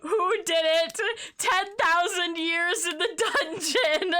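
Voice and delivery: high, laughing mock decree; laughing mock sentence. Real, not acted. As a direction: pronounce sentence through laughter.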